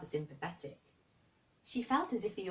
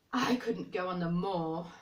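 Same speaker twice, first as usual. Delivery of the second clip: resentful; 7 dB louder than usual. Acted. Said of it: "he said in a resentful tone".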